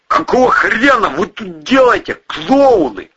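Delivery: high, loud indignant outburst; indignant demand. A narrator's reading. Gang boss confronting his battered pals, indignant. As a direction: demand it as if outraged. Angry confrontation after near shoot-out.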